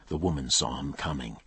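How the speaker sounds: usual speaking voice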